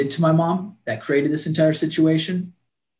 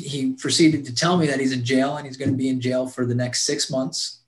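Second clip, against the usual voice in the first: usual speaking voice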